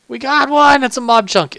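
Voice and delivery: high, loud triumphant cheer; excited cheer. Real, not acted. Gamer finally getting a mob chunk, high loud cheer. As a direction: cheer it out, thrilled. Got the drop at last.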